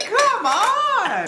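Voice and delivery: high-pitched mock protest; exasperated cry. Partygoer fed up with the placenta party, exasperated cry.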